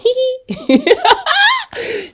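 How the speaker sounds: high-pitched gleeful cackle; high squealing laugh. Real, not acted. Host laughing at own plug, high squeal. Laughs after plugging herself.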